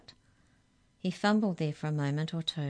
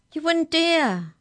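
high, loud, drawn-out protest; alarmed cry; 12 semitones higher, 9 dB louder, slower than usual. A narrator's reading. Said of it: "cried Molly".

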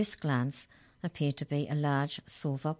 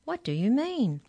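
high, louder, puzzled question; baffled demand; 9 semitones higher, 5 dB louder than usual. A narrator's reading. Hex checker questioning the giants, baffled. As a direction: demand an explanation, pitch swooping up.

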